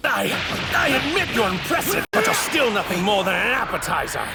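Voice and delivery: very high, defiant battle shout; shouted taunt. Acted. Villain taunting the impressive Saiyan, defiant shout. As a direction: hurl the insult mid-fight.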